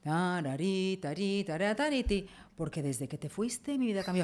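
a hint of a DJ voice-over, cracking up at the end; mock translation voice-over. Shown as a demonstration. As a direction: imitate the radio translator over the song. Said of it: "Por ejemplo, suena la canción".

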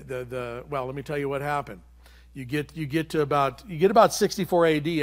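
usual speaking voice

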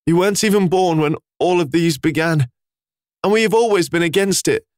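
high, breaking, grieving plea; father begging the beast in a broken voice. A narrator's reading. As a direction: speak through a cracking voice. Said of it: "Clef said with a broken voice".

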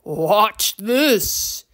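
gravelly troll showman; rough troll voice, setting up a trick; confident creature command; Torque. A narrator's reading. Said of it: "deep and gravelly voice".

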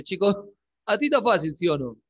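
ordinary talk, nothing marked

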